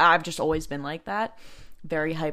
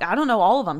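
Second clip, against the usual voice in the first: irritated; 4 semitones higher, 9 dB louder, faster than usual. Retold or imitated. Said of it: "starting to get really irritated with him".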